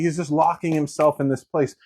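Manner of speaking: ordinary talk, nothing marked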